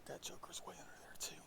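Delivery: half-whispered, under the breath; whispering. Real, not acted. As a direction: talk in a loose, hushed whisper. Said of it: "Why the heck am I whispering?".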